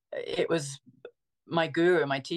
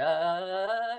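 long drawn-out rising "uhhh"; sustained rising wind-up noise; ratcheting-up "uh"; 4 semitones higher than usual. Retold or imitated. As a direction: let the sound climb steadily higher like winding tension. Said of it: "it was ratcheting up and up and up".

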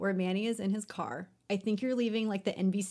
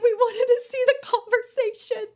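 high, loud, laughing complaint; mock whiny complaint; 14 semitones higher, 7 dB louder than usual. A viewer complaining the clip ended, high and whiny. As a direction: whine that the good part got cut off. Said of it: "I'm like".

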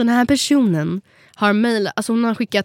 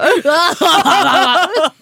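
high, loud burst of real laughter; 7 semitones higher, 6 dB louder than usual. Real, not acted. Co-host still cracking up over the humour, laughing loudly.

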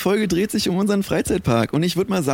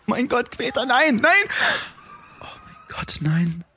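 mock horror; 7 semitones higher, slower than usual. Horror at what Peter does.

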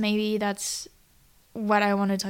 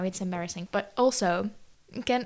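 ordinary delivery

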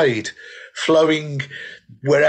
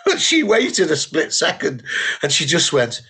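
ordinary delivery

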